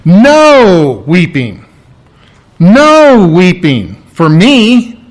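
slow, loud stress on "No"; emphatic no. Acted. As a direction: draw out "No" forcefully before "weeping". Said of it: "emphatic no".

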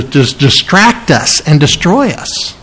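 usual speaking voice

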